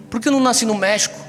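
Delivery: usual speaking voice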